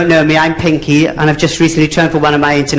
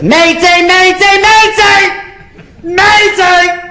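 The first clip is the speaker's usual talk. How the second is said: very high, strained distress call; panicked mayday; 4 dB louder, much slower than usual. Acted. Novice passenger radioing for help after the pilot dies, panicked. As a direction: shriek it in mock panic.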